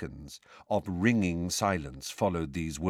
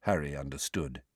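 usual speaking voice

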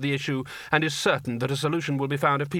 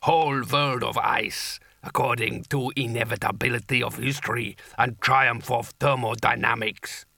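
rumbling giant; 3 semitones lower than usual. A narrator's reading. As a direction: rumble it like a huge ice giant. Giant figure continues.

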